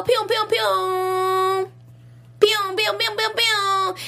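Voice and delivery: high pew-pew gun noises; gunshot sound effects. Pretend. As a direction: fire off toy gun sounds. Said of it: "my sound effects".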